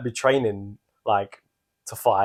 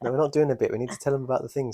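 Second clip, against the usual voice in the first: ordinary talk, nothing marked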